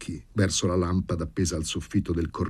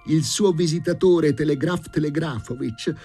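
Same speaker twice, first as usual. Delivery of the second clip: high-pitched indignant woman's voice; indignant Daria, the housekeeper; 11 semitones higher than usual. Acted. Housekeeper protesting to the professor about his visitor, high-pitched and indignant. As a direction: complain in a shrill, scandalised high voice.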